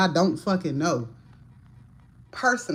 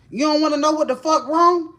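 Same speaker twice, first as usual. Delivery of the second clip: raised, high-pitched confrontational demand; loud, aggressive; 8 semitones higher, 5 dB louder than usual. Retold or imitated. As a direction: challenge them loudly, pitch jumping up. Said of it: "when you get loud like that and you get aggressive".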